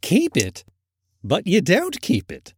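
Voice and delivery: put-on high, astonished young nephew; lightly protesting, high-pitched nephew; incredulous nephew. A narrator's reading. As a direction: echo him in high disbelief. Nephew answers his uncle in disbelief.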